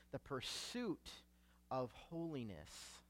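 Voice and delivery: "pursuit" set apart softly, then a pause; hushed, deliberate weight on "pursuit", lifting at its end; emphasis on pursuit. Real, not acted. Preacher underlining a key word for the congregation, emphasis on "pursuit". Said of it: "I want to emphasize that word".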